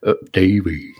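deep drawn-out Davey; goofy low cartoon call.

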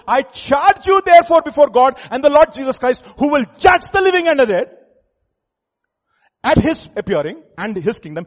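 fiery loud preaching, high and loud. Acted. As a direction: thunder it from the pulpit. Preaching at full force.

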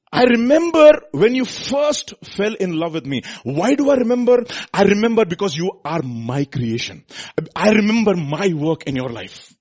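loud, high, fervent preacher's voice; full-force preaching. Acted. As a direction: proclaim it to the congregation.

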